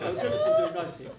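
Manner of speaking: laughing delight. Real, not acted. Host laughing at a Guinness request. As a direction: laugh it out in glee. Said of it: "Now, you got me".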